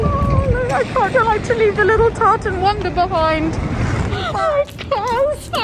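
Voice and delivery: high, strained tearful voice; tearful goodbye. Real, not acted. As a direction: near tears, pitch lifting high. Leaving the dog behind.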